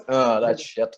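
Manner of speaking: usual speaking voice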